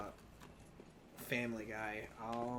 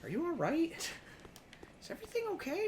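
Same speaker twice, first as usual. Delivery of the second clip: concerned therapist voice; much faster than usual. Acted.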